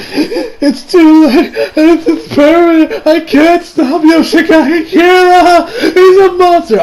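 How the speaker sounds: high, stretched-out tearful wailing; despairing wail. Retold or imitated. Acting out Hayato crawling away in defeat, mock despairing wail.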